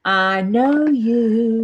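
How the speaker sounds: very slow, stretched-out words; long, teasing repeat; drawn-out teasing. Real, not acted. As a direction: drag each word out knowingly.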